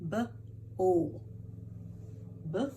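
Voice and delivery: "B-o" as buh, pause, ull; "B-o" slowly sounded, then "B" alone; sounding out. Shown as a demonstration. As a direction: isolate each sound of "B-o". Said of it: "this goes with the second syllable".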